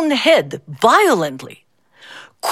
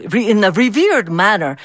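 usual speaking voice

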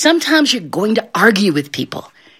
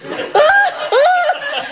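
high wailing sobs; hammed-up sobbing wail; mock crying. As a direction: burst into comic bawling. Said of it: "And she starts crying".

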